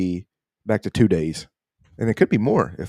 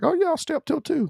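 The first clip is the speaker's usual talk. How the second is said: mock younger self.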